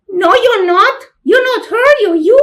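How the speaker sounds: put-on a kid sneering at her sister; little girl's voice. Little sister mocking the jealous older sister, high child voice. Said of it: "children's voice".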